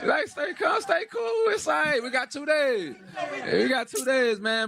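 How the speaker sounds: high, teasing call to stay cool; mocking calm-down. Real, not acted. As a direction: needle them like a big brother. Taunting the rival camp again.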